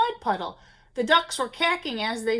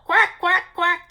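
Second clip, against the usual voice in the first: higher, louder quacks; ducks quacking; 6 semitones higher, 7 dB louder, slower than usual. A narrator's reading. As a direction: call out quacks. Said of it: "they called".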